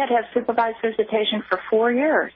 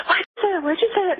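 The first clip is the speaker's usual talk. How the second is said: imitating a frightened daughter, pitched higher; panicked daughter; 9 semitones higher than usual. Mother retells her scared daughter's outburst, imitated frightened high voice. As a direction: mimic her terrified teen girl pleading. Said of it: "she was like".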